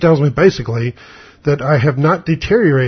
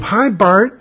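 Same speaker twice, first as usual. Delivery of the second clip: mock-bubbly hello, pitched up; cheery greeting; 7 semitones higher than usual. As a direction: imitate a doctor's higher, upbeat hello. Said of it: "in a real good mood".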